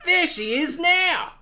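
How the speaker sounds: high, slow Aussie pride; Australian accent. Acted. Steve-O pointing out Cairns to Bond, Australian accent. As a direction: show it off like a proud local. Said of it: "Australian accent".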